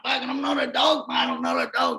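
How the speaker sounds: high shouted protest, trailing to a rasp; shouting back. Retold or imitated. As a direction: yell back in denial. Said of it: "I'm going".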